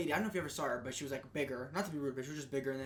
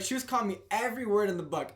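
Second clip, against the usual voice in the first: ordinary talk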